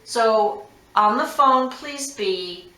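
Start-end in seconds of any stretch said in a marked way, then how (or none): none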